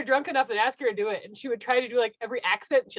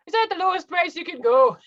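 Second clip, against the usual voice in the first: put-on loud, higher-pitched Hutterite accent; 6 semitones higher, 5 dB louder than usual. Coworker mimicking Hutterite customers haggling at work, Hutterite accent. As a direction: put on a Midwestern German-type Hutterite accent. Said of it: "It's like a whole other accent".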